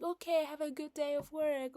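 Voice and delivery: light imitation of a daughter sending mom off; happy little girl.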